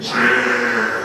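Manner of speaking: very slow drawn-out low "shaak"; spirit's exit sound. Retold or imitated. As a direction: draw the sound out low and long. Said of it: "소리를 내더니".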